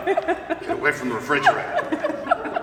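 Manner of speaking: high-pitched laughing order to back off; laughing shoo. Retold or imitated. Mother shooing big sons from the fridge, laughing high. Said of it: "and my first instinct is".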